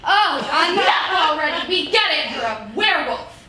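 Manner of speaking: loud, high, fed-up shout; shouting out of sheer annoyance; exasperated outburst. Acted. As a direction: cut them off in exasperation. Fed up with rival's hints.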